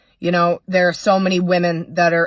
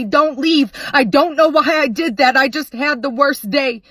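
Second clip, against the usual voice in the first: much higher, louder frantic begging; husband begging his fleeing wife, high and loud; desperate pleading; 9 semitones higher, 5 dB louder than usual. Acted. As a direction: beg her not to walk out the door. Abuser begging her not to leave.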